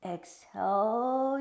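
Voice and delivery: loud, slow exhale cue with an airy breath sound; long loud exhale cue. Shown as a demonstration. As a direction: push the breath out with the class.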